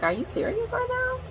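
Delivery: high-pitched incredulous question; retold disbelief. Vlogger retelling her reply to a coworker, incredulous.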